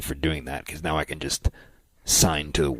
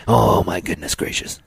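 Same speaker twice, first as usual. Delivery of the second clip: slow drawn-out announcer exclamation; 4 semitones higher, slower than usual. Shown as a demonstration. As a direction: savor each word like a ring announcer. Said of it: "That's my announcer voice".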